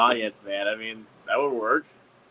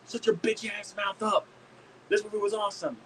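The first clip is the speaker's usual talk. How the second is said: mock indignant fan; 9 semitones higher, 3 dB quieter than usual. Fan telling off the heckler, mock indignant. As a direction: scold in a high voice without raising volume. Acts out an angry fan again.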